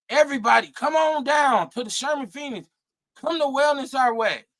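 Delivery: high, shouted invitation; calling out. Retold or imitated. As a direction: call everyone down like a hype announcer. Calling people to the event.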